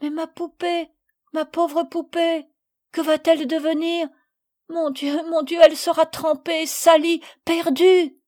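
high, rising to loud lament; despairing wail. A narrator's reading. Little girl lamenting her soaked lost doll.

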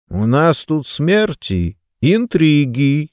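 slow, hollow voice from the grave; sepulchral voice. Acted. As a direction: drag it out like a gravedigger. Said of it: "загробным голосом произнёс он".

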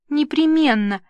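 high, loud and eager; gushing assent. A narrator's reading. Papa indulges Nina's every wish, gushing assent. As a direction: agree effusively, humouring her.

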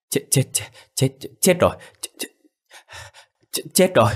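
put-on a frightened boy who can't get the words out; breathless panic. A narrator's reading. Boy gasping out news of a drowning, breathless and panicked. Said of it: "nói không thành hơi".

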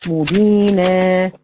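"مبينا" slow, ending on a long a; stop with alif. Shown as a demonstration. Teacher models the right stop: slow "مبينا" with long a. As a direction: draw the final alif of "مبينا" out. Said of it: "ده خطأ المد العوض عن التنوين".

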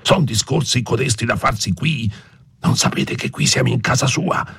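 hushed, high-strung reproach; hissed scolding in an ear. A narrator's reading. Priest warning they are in the lord's house, hissed scolding. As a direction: rebuke urgently without being overheard. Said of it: "le gridò in un orecchio don Abbondio".

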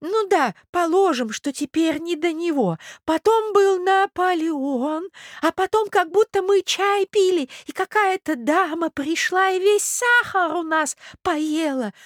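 high, slow doddering prince; old prince, muddled. Acted. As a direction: recollect laboriously in a high old voice. (старый князь, путано вспоминает, высоко и медленно)

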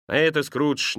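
ordinary delivery, nothing marked

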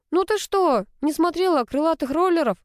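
high, amazed disbelief; surprised. A narrator's reading. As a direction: sound genuinely amazed. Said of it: "удивилась Рита".

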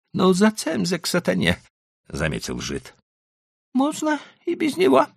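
put-on high, pleading old merchant. A narrator's reading. Leiba remarks.

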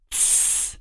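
unvoiced sharp shush; shushing hiss. A narrator's reading. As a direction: hiss for silence. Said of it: "произнёс Том".

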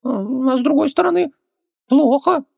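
very high-pitched little djinn; squeaky creature voice, backing down; Хубаксис, conceding. Acted. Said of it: "не стал спорить Хубаксис".

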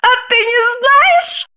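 high voice laughing through the words, slowed; mocking laugh. Wife laughs at her husband, mocking high laughter. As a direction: cackle it at him. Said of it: "рассмеялась".

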